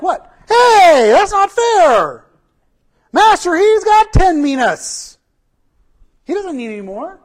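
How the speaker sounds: high, drawn-out mock shout of complaint; outraged protest. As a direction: cry foul loudly like an indignant crowd. Everyone protests to the master.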